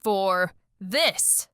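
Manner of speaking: very slow, word by word; slow, deliberate refusal; measured impatience. Acted. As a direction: space out every word with long gaps. Impatient with person blocking her.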